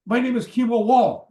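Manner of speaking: loud, high acted announcement; emphatic self-introduction. Caller giving his name at the door, loud and emphatic.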